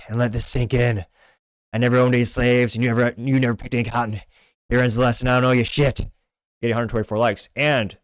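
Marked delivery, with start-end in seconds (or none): 0.1-6.1 forceful put-on tough-guy voice; badly faked movie gunslinger delivering a verdict; Clint Eastwood impression. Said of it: "do a Clint Eastwood accent".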